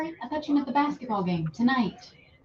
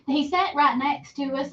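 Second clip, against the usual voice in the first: ordinary talk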